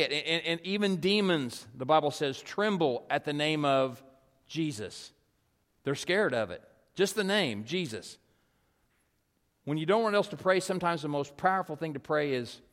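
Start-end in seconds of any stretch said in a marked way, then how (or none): none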